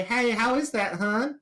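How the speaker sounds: high-pitched chatty waitress impression; intrusive waitress. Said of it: "have a waitress come up".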